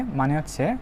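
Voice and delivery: ordinary talk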